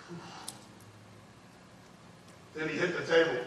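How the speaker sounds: ordinary talk, nothing marked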